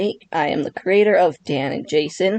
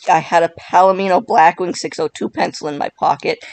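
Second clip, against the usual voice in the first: usual speaking voice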